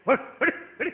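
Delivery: sharp mock shoo sounds; shooing noise. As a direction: shoo the cat away. Voicing shooing the cat.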